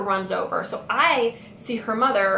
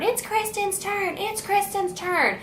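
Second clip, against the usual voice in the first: much higher whiny repeat; higher, mimicking a panicky teacher; mock teacher scream; 11 semitones higher than usual. Teacher shouting from the back seat, high mock whine. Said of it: "in the back screaming".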